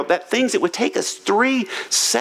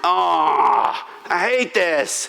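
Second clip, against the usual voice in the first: 1 mock groan; much slower than usual. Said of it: "but I'm like".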